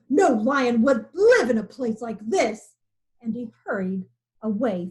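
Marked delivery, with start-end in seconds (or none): 0.1-2.7 roaring. A narrator's reading. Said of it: "he roared".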